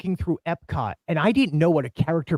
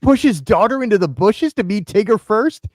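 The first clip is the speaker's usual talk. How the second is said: loud, raised voice of an appalled bystander; a hint of a scandalized passerby; shocked onlooker; 6 semitones higher, 6 dB louder than usual. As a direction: gasp it out as a stranger watching. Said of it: "from a third person".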